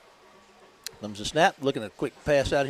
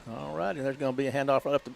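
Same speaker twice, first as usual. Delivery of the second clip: usual speaking voice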